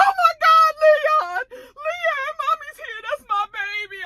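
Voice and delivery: imitating a mother's anguished cry; mother wailing over son. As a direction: shriek his name like a grieving mother. Mother finds dead son.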